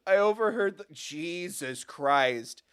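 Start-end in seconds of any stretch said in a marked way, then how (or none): none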